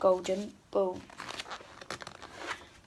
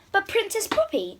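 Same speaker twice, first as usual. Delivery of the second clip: high little frog plea; pleading frog; 11 semitones higher than usual. Acted. Frog pleads with the princess from the corner. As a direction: squeak it up as a plea.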